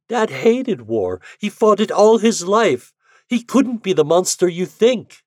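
put-on young woman's pleading voice, high and rising; Anne pleading. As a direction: defend her father through tears. Anne pleads for her father.

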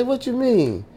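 high-pitched quoted query; re-enacted confused reply; retold puzzled question. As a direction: ask it high, taken aback.

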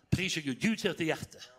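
ordinary talk

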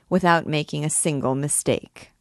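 usual speaking voice

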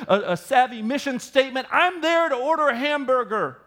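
self-centred customer. Acted. Pastor mocking a demanding restaurant consumer. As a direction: play the entitled restaurant customer.

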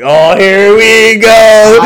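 high, loud groan of oh here we go; mock exasperated shout. Retelling his reply to the bar guy, mock exasperated shout. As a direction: belt it like you've heard this story before.

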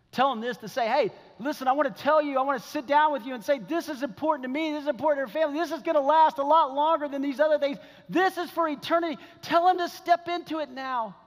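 high, urgent pulpit exhortation; impassioned preaching. Acted. Preacher urging a dad to act now, impassioned. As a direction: drive each command home with fervor.

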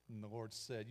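usual speaking voice

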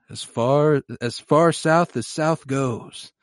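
slow, grand, mysterious pronouncement; cryptic Jaime. Retold or imitated. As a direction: drag it out like an oracle. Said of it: "Jaime goes".

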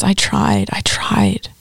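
whispered, heartbroken; sorrowful whisper. Acted. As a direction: breathe it out in grief. Weeping Jesus over his enemies.

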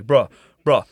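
slurry mock drunk bruh; drunk friend. Imitating drunk friend Jesus.

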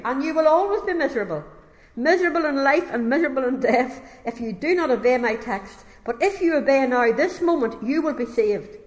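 high, loud pulpit warning; urgent preaching. Acted. Preacher warning a miserable young stranger from the pulpit, urgent. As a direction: press the warning home like a preacher.

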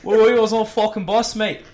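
put-on over-the-top Australian accent, yelled through a laugh; mock Australian accent. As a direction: bark it out like a cocky Australian bloke picking a fight. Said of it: "I didn't realize he was Australian now".

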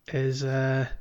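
ordinary talk

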